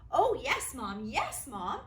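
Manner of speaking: put-on excited girl calling yes to mom; eager yell. Girl answering her mother, eager mild yell. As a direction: blurt it out eagerly. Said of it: "Erica yelled".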